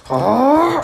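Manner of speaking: high, loud attacking yell; villain's attack cry. Acted. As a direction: cry out as you lunge.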